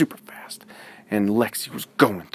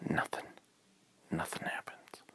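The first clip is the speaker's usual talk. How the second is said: softer whispered letdown; hushed whisper; 13 dB quieter than usual. A narrator's reading. Narrator whispering that the pillow will not fly. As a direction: whisper the disappointment.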